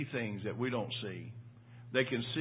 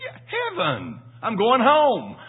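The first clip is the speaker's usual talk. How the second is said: higher and much louder, rising to "home"; wife's joyful announcement; 10 semitones higher, 13 dB louder, slower than usual. Retold or imitated. Wife telling her husband she is going to heaven, loud joyful voice. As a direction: let her burst out with gladness. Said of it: "She said".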